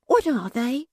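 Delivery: hushed high whisper. Acted. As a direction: whisper it eagerly. Barnaby whispers question.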